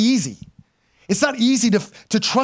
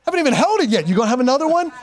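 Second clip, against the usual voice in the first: higher, incredulous mock retort; mock exasperation; 7 semitones higher than usual. Dad mocking hospital visitors asking about another baby, incredulous. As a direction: toss back their question in disbelief. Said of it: "I'm like".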